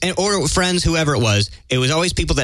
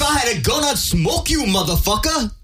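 a badly faked British gangster accent, pitched up; a clumsy, hammed-up British accent; terrible British accent; 7 semitones higher than usual. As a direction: ham up a London gangster threat. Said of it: "That was a terrible British accent".

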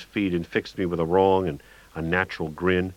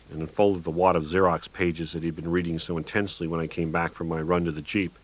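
plain reading voice

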